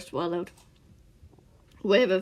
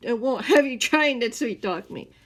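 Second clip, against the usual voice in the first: louder, firm threat; stern warning; 5 semitones higher, 8 dB louder than usual. Acted. The man warning Scar off sweet talk, loud and firm. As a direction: put your foot down.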